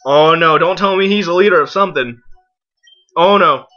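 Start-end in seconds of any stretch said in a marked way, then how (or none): none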